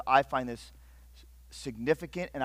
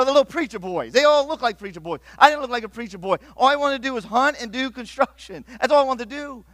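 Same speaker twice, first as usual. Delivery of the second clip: ordinary delivery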